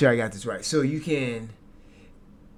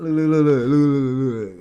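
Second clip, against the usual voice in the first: loud, insistent repeated look, higher; excited urging; 3 semitones higher, 10 dB louder, slower than usual. Real, not acted. Streamer urging chat to watch the replay, loud and excited. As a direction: pump the words out, grabbing the chat's attention.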